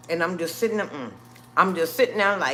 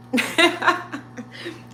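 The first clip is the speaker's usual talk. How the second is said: nervous laugh; 10 semitones higher than usual. Real, not acted. Nervous laugh at own story.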